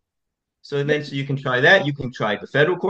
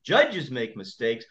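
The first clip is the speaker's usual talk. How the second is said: normal talking voice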